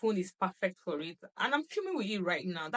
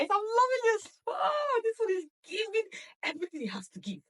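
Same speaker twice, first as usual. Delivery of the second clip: squealing delight; 13 semitones higher than usual. Real, not acted. Gushing over new phone.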